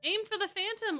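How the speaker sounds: shout. Acted. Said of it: "Ortho shouted".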